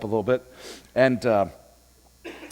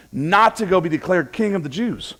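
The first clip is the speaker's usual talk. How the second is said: normal talking voice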